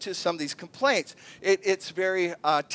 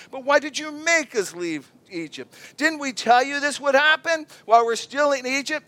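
higher, whiny told-you-so; sarcastic complaint; 6 semitones higher, 3 dB louder than usual. Retold or imitated. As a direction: nag him with it. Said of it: "That's sarcasm".

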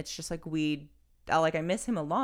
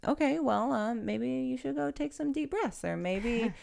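lightly voiced little boy, higher and earnest; four-year-old's advice; 8 semitones higher than usual. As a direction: play the small boy giving grown-up advice. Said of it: "especially the four-year-old, sometimes he's like".

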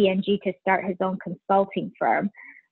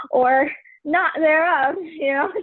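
laughing; 8 semitones higher than usual. Real, not acted. Laughs about deciding on kids.

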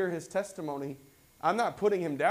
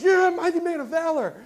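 high, loud, puffed-up yes; proud agreement; 12 semitones higher, 9 dB louder than usual. Retold or imitated. Preacher mock-agreeing with God's praise, high and proud. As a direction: swell with pride at the title. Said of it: "I'm like".

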